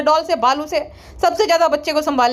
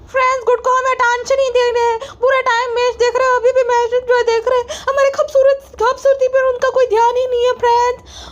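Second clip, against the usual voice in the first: higher, sugary "friends" vlogger impression; mocking her sister-in-law's vlog voice, pitched higher; 9 semitones higher than usual. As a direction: ham up a fake-sweet influencer addressing fans.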